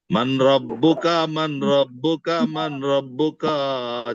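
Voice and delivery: "मन रब्बुका" asked very slowly and deliberately; slow drill question. Shown as a demonstration. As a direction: pose "मन रब्बुका" slowly, waiting for the class to answer. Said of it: "जवाब दीजिए".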